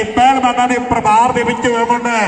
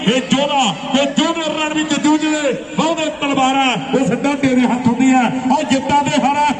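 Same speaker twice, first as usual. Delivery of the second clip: higher, rapid-fire chant for claps; frantic rural sports commentary; 4 semitones higher than usual. Acted. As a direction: whip the crowd into clapping.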